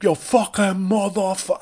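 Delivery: high, drawn-out mock New York yell; Mike Francesa New York accent. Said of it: "atrocious New York Mike Francesa a-, accent".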